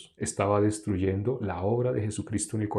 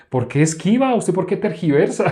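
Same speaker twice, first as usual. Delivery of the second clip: mock accuser; 8 semitones higher, 6 dB louder than usual. Voicing accusers of Jesus.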